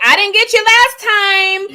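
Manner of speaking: high, loud, slow menacing shark. Retold or imitated. Wife voicing the shark that missed her, high and slow. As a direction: taunt her as the hungry shark. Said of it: "He'd be like".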